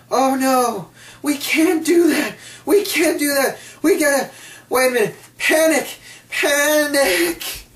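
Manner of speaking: mock panic. Mocking WWE's panicked reaction.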